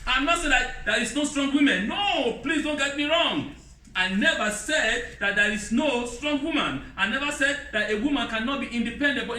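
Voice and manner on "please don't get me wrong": ordinary talk, nothing marked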